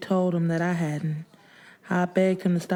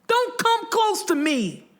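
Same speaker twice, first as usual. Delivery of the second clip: high, drawn-out furious yell; 14 semitones higher, slower than usual. A narrator's reading. Widow yells at rival in hospital lobby, furious. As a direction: warn her off in a high scream. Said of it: "I yelled at her".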